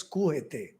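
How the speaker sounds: Quixote as skoo-eh-tay; Spanish-style pronunciation. Shown as a demonstration. Speaker recalling what he thought it was, Spanish-style Quixote. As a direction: attempt Quixote as koo-EH-tay.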